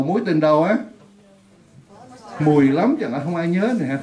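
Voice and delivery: ordinary delivery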